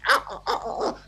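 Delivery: soft, high imitation monkey hoots; monkey hooting. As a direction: hoot like a monkey under your breath. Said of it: "he's a monkey".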